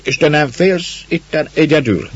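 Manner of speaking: put-on a rough neighbour talking down to a child; gruff woodsman. A narrator's reading. Man talking to the girl, gruffly. Man's concern.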